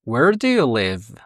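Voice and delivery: slow, careful English model; teacher modelling slowly; slow model question. Shown as a demonstration. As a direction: spell it out slowly for learners.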